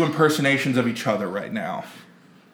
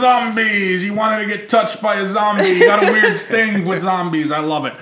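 put-on Carl from Aqua Teen; 4 semitones higher than usual. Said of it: "sounding like Carl from Aqua Teen".

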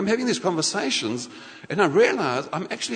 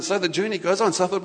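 usual speaking voice

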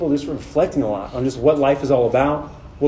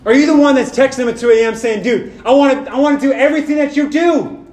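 higher, louder, driving preacher's voice; full-force preaching; 7 semitones higher, 4 dB louder than usual. Acted. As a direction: preach it out to the room, rising and urgent.

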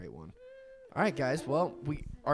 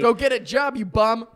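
loud, raised-pitch joke heckle; mock scolding shout; 7 semitones higher, 10 dB louder, slower than usual. Completing friend's lyric as his mama, mock scolding. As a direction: cut in shouting like a fed-up mom.

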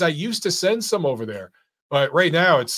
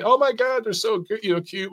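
put-on higher, gushing teen fan voice; mock swooning fangirl; 6 semitones higher than usual. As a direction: play a gushing teenage fangirl. Mocking teenage girl fans.

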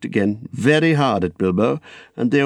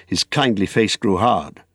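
ordinary delivery, nothing marked

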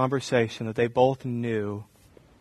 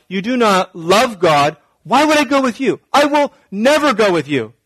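loud, raised-pitch self-righteous denunciation; indignant refusal; 4 semitones higher, 6 dB louder than usual. Acted. Jacob imagined rebuking wicked Esau, indignant and loud. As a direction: refuse him with heavy, preachy force.

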